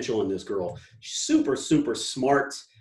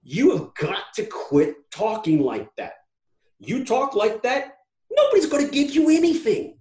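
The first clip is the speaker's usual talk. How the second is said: exasperated plea; 7 semitones higher, 4 dB louder than usual. Retold or imitated. Host retelling his scolding of the devastated girl, exasperated. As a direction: plead hard, voice rising with frustration. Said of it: "I'm like".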